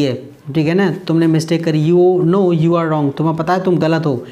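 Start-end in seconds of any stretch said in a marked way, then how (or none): 1.9-3.2 very slow, clear English line; slow teacher's read; slow model sentence. Shown as a demonstration. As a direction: say each word slowly for learners. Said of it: "teacher models English slowly".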